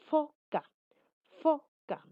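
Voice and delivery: "foca" loud and high, the "f" pushed out with friction; fricative demonstration. Shown as a demonstration. Teacher testing air passing with difficulty, forceful demonstration of "foca". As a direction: punch out "foca" as a separated model word. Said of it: "el aire va a salir con algo de dificultad".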